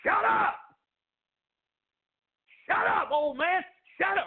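loud, high shouted put-down; acted crowd yelling at a beggar; harsh rebuke. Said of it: "Sounds harsh".

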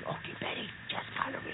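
hushed whisper. Acted. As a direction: whisper it so nobody hears. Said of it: "we gotta be real quiet now".

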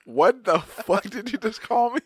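high, slow, loud mock offence; mock outrage. Joking with a friend who spelled Mississippi, mock outrage. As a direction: act hugely insulted as a joke.

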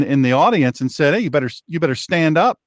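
ordinary delivery

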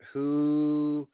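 usual speaking voice

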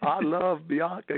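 slow, slightly raspy politician; Bill Clinton. Shown as a demonstration. Impressionist shows similar voices, slow raspy president impression. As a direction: stretch it out in a president's voice. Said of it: "you could just go from".

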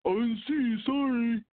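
high, mocking sheepish sorry; mock apology. Mocking a driver who cut him off, high sheepish apology. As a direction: ape a careless driver's excuse. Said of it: "be like".

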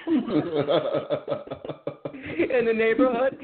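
words breaking into laughter; can't hold the laugh while telling it. Real, not acted. Friend retelling the cop story, laughing through the words.

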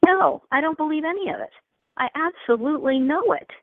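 high, emphatic declaration; firm conviction. Retold or imitated. Answering a famous actor about belief, emphatic and certain. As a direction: retell a resolute answer with full certainty. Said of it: "I looked at him and I said".

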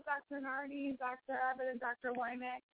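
normal talking voice

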